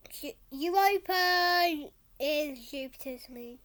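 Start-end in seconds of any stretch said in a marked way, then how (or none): none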